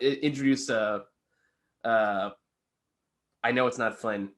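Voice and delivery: usual speaking voice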